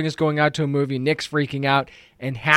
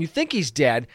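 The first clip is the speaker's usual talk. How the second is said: ordinary talk, nothing marked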